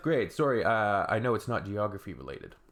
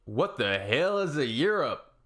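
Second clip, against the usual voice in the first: higher, slow, dumb-sounding quote voice; mock clueless voice; 4 semitones higher, 4 dB louder, slower than usual. Reading out a clueless comment in a mock dumb voice. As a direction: play the confused commenter, hammed-up.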